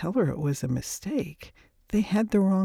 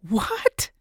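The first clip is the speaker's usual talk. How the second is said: higher, sharply rising shocked question; stunned disbelief; 4 semitones higher than usual. Acted. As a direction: react in acted shock, pitch jumping up. Woman hears shocking death news.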